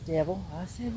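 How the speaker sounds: usual speaking voice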